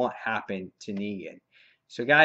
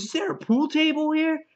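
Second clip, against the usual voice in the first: very high-pitched excited asking; acted delight, pitch way up; eager question; 14 semitones higher than usual. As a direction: ask like a thrilled houseguest. Acting Negan's eager question about house.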